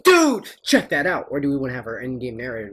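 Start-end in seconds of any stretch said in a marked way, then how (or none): 0.0-1.3 excited caster. Retold or imitated. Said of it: "let the caster be like".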